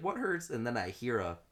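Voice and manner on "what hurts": alarmed question. Retold or imitated. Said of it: "And I was like".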